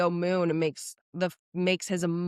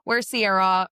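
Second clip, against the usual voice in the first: usual speaking voice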